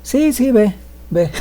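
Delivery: high, absent-minded brush-off; lightly voiced dazed lady; distracted woman. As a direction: wave him off while lost in thought. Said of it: "así toda perdida, dice".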